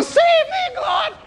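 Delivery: very high, panicked shriek; screaming. Acted. Old man hitting the cabin stairs, high desperate scream. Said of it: "still screaming".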